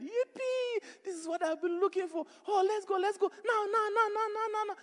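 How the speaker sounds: squealing very high, giddy and loud; an overjoyed chicken bouncing to go; shrill cartoon chicken squeal of joy; excited chicken. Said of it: "The chicken was excited".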